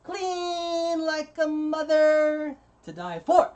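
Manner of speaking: drawn-out, high-pitched loud catchphrase; hammed-up impression, slow and soaring; Bobby Vu. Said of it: "I'll do my best Bobby Vu impression".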